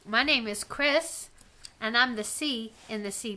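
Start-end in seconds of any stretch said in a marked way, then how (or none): 0.1-1.3 talking while chewing gum; words round a wad of gum. Real, not acted. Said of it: "I'm chewing gum right now".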